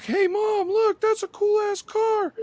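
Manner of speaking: put-on very high, slowed little boy voice; excited kid. Kid telling his mom about a car, high and slow. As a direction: play a wide-eyed eight-year-old. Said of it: "he's like".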